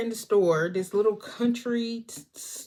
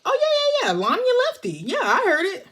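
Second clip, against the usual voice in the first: very high, loud, gushing imitation; hammed-up, high-pitched eager agreement; mock eager cashier; 11 semitones higher, 5 dB louder than usual. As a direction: blurt it cheerfully in a squeaky oblivious voice. Cashier's eager clueless answer, acted.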